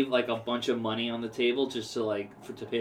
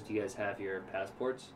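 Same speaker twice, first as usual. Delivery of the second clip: softer, sheepish back-and-forth; sheepish hush; 4 semitones lower, 10 dB quieter than usual. Retold or imitated. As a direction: confess quietly what you've done. Quiet embarrassed exchange, retold.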